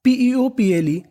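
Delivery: usual speaking voice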